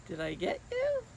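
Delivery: very high playful tease; teasing. Acted. Miss Julie teasing the kids she tricked, high and playful. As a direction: catch them out gleefully.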